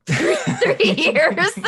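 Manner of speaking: cracking up at her slip; laughing. Real, not acted. Laughs at her own mistake.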